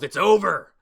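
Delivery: loud, high gloating shout; gloating villain shout; villain yell. Retold or imitated. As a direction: yell it as if victory is certain. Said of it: "he yells".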